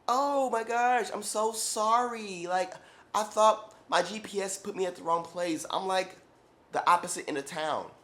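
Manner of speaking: hammed-up ditzy client voice; mock flustered apology. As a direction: overplay her sugary apology. Client apologizing for wrong location.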